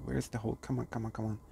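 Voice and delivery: muttered to himself. Real, not acted. Anxious search for hole, muttering.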